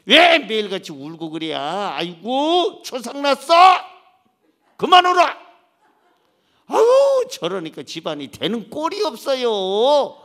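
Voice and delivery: 높고 크게 버럭 짜증내듯; 우는 아내에게 버럭하는 남편 흉내; 짜증내는 남편. Retold or imitated. (우는 아내에게 짜증내며 버럭하는 남편)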